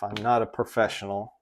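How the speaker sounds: ordinary talk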